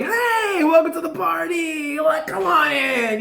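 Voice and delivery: high squealing party host welcome; welcoming spirit. Retold or imitated. Spirits welcoming the reader in, high party-host squeal. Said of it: "They're like".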